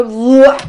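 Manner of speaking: sudden loud mock wail of greed. Mimicking attachment lunging at the cake with a loud wail. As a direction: throw out a loud grabby cry. You just go.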